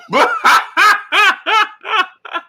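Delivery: loud, squealing high laugh; high burst of laughter. Real, not acted. Streamer cracking up at a viewer's story, high squealing laugh.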